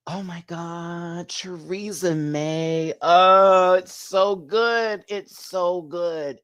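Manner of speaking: high, drawn-out swooning praise; awestruck, lingering on each phrase; gushing. Real, not acted. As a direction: swoon over it, stretching every word. Gushing over a favourite dress.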